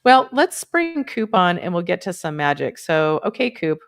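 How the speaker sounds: usual speaking voice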